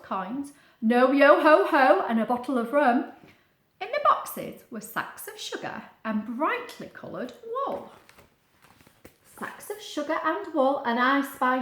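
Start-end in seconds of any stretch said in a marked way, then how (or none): none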